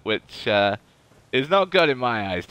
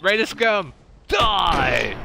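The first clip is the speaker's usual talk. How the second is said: battle cry; 5 semitones higher, 3 dB louder, much slower than usual. Acted. Shooting at raider.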